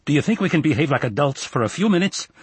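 exasperated call, not a full shout. A narrator's reading. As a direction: call to the next room with reproach. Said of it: "Gary called into the kitchen".

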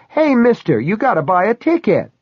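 high-pitched fairground woman; cawing carny woman. A narrator's reading. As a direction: call after a customer in a high caw. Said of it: "the woman in the ticket booth cawed".

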